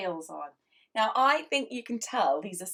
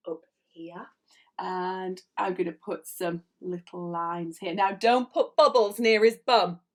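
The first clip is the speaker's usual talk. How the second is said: ordinary talk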